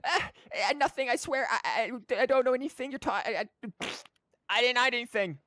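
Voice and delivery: put-on high, flustered, stammering girl; startled little girl caught out; panicked child. Collei panicking when startled.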